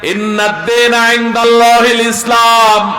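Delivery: preacher crying out a Quran verse; proclaimed verse. Acted. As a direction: call the verse out with full force. Quoted verse, preached loudly.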